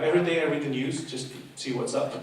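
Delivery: normal talking voice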